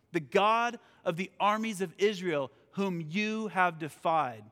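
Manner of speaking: emphatic, proclaimed challenge; scripture reading, David's defiance. Acted. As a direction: declare it boldly, leaning on the key words. Reads David's defiant answer.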